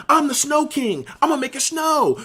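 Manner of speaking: put-on high, grandiose drunk proclamation; mock Snow King. [name] spraying the extinguisher, high grand drunk boast. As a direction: crown yourself king of snow.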